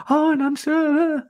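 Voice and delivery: high, whimpering imitation; mock teary. Imitating teary coworker asking for confirmation, mock weepy. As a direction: squeak it as if about to cry. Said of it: "she had teary eyes".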